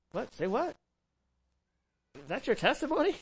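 mock disbelief, pitch climbing on the questions; incredulous listeners. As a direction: play a baffled listener questioning it. Voicing listeners baffled by the boast.

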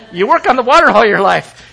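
normal talking voice

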